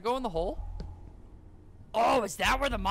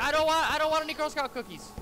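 mock panicked protest; 7 semitones higher, 6 dB louder than usual. Pleading with imagined cookie seller.

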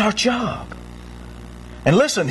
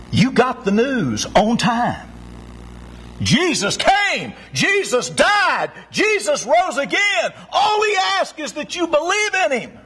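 building preaching; 8 semitones higher than usual. Acted. Preacher proclaims the gospel to the congregation, building to a shout.

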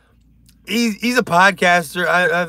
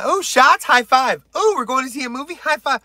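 mocking eager high-fivers; 10 semitones higher, 4 dB louder than usual. Mimicking women eager to high-five.

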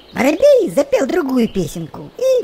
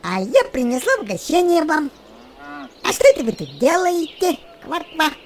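lightly voiced merry frog, higher; cheerful frog; 4 semitones higher than usual. A narrator's reading. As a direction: chirp in like a bubbly frog. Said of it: "Она весело квакнула и сказала".

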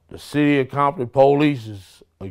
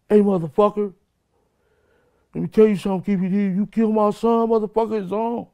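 much higher, hammed-up furious shouting; retold angry yell; 10 semitones higher than usual. Retelling how the captain yelled at him, in a mock furious yell. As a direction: play the enraged police captain threatening him.

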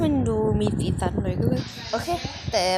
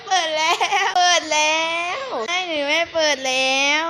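higher, louder, cracking with laughter; laughing, near crying; 6 semitones higher, 6 dB louder than usual. Real, not acted. Player argues with the game while laughing hard, voice cracking. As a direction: plead through helpless laughter. Arguing whether it's open.

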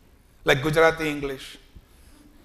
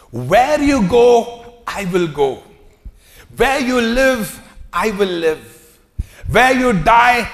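passionate vow; 2 semitones higher, 6 dB louder, slower than usual. Acted. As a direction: swear it with all her heart, slowly and loudly. Ruth's vow to Naomi.

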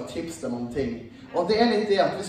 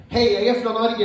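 cheery loud greeting; 4 semitones higher, 6 dB louder than usual. Retold or imitated. Greeting Swedish youths loudly.

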